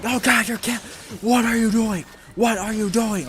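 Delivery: high, loud cries of alarm; acted panicked yelling. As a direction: yell in hammed-up horror at the driver. Acts Cap reacting to boat mayhem.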